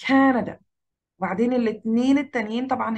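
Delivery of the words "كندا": كندا as CA-na-da, "Ca" high and loud; كندا falling away after a strong start; first-syllable stress. Shown as a demonstration. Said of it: "على كا".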